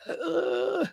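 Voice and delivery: a short, high, breathy ugh of disgust; allergic ugh. Retold or imitated. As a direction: recoil from the cat with a soft ugh. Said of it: "I'll be like".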